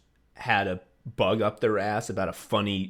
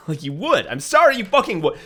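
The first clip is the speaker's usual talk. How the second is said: high, loud, fast insistence; worked-up, laughing protest; exasperated rant; 8 semitones higher, 7 dB louder than usual. Real, not acted. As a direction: burst out in comic exasperation. Ranting about the bad joke.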